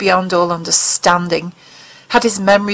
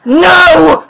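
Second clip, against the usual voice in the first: much higher, louder no; scream; 8 semitones higher, 8 dB louder than usual. Acted. Mulder screams at the alien in fury. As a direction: explode with the word. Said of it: "he screamed".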